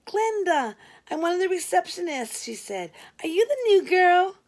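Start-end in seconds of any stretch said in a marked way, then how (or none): 0.0-2.4 put-on much higher, bubbly young woman; chirpy Glenda. Late receptionist introducing herself, high and bubbly. 3.2-4.4 put-on much higher, bubbly young woman; chirpy Glenda. Late receptionist introducing herself, high and bubbly.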